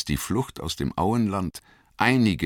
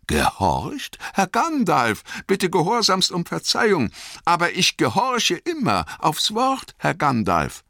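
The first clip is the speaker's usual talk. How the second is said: high, quick and humble servant voice; eager, deferential young gardener; high-pitched and hurried, bowing and scraping; Sam the gardener; 13 semitones higher than usual. Acted.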